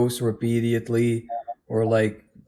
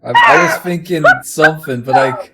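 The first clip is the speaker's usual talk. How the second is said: louder, breaking into laughter; laughing while talking; 5 semitones higher, 9 dB louder than usual. Real, not acted. Trying to tell friend something through a laughing fit. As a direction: push words through giggles.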